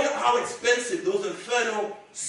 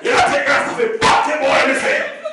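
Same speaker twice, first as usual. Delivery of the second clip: deep, menacing Jamaican patois, low and loud; a hostile Jamaican dad scolding his daughter's fiancé; Jamaican father; 5 semitones lower, 6 dB louder, slower than usual. Retold or imitated. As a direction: growl it like a scary Jamaican dad. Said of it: "his reaction was".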